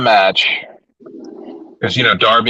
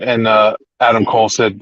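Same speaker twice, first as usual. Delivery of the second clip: ordinary talk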